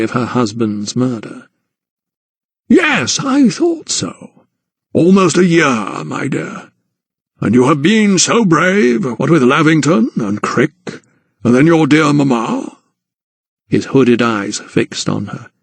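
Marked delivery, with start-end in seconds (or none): 2.7-12.8 a hint of an old gentleman, higher and slow in places; lightly voiced patronising guardian; Sir Montague, lightly voiced. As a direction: narrator lightly playing a condescending old aristocrat.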